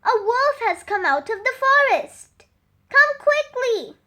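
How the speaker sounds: high, loud, fast alarm; shouting. Acted. Sam calling villagers to the hill, high fast shout. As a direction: rush the warning out, urgent. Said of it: "he shouted".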